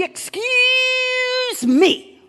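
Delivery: drawn-out "Excuse", then "me"; mock-indignant dismissal, stretched first word; sassy rebuff. Believer brushes off a powerless thought, sassily indignant.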